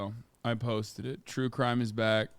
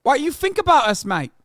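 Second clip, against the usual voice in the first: hammed-up New Zealand accent, much higher and louder; shrill Kiwi caricature; 12 semitones higher, 8 dB louder than usual. Streamer yelling at a Kiwi chatter in a mock accent. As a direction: scream it like a mad Kiwi bloke.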